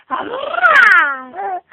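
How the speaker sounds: very high, loud squeal; squealed peekaboo shout. Acted. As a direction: pop out squealing at the baby. Peekaboo game with baby.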